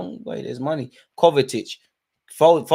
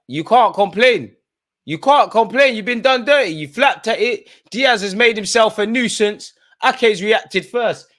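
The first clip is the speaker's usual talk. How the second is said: heated protest; 8 semitones higher, 5 dB louder than usual. Real, not acted. Arguing a penalty call heatedly.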